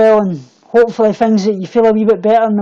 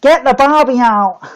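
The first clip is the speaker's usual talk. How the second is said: slow, lightly put-on Aussie drawl; mock Australian accent. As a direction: play at being Australian, drawn out. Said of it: "speaking with Australian accents".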